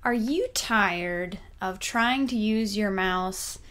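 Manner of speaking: normal talking voice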